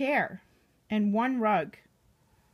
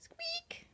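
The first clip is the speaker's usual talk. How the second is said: tiny high whispered squeak; breathy high squeak like a mouse; 12 dB quieter than usual. Pretend. Reading to a child, a tiny mouse squeak. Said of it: "The mouse squeaks one squeak".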